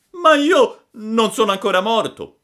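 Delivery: high, loud frightened protest; alarmed puppet. A narrator's reading. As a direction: protest in a scared child squeal. Puppet protests to the undertaker rabbits. (protesta acuta e spaventata)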